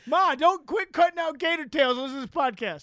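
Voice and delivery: high, loud comic son voice; son pestering Ma. Pretend. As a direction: urge Ma to listen, hammed-up. Plays son calling his mother.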